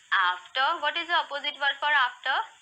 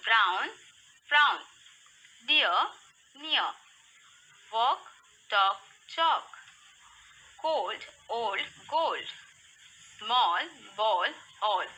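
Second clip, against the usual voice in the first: slow, separated rhyming words; slow read-aloud; slower than usual. Shown as a demonstration. Teacher reading rhyming words for kids to repeat, slowly. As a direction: give each word room, pausing after it. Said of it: "slowly, read aloud".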